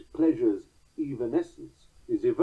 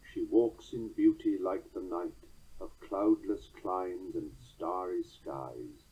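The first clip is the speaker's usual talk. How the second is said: slow measured verse reading; slow, rhythmic poetry recital; poem reading; 5 semitones lower, 4 dB quieter, slower than usual. Shown as a demonstration. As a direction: read it with a steady four-beat lilt. Reads poem example aloud.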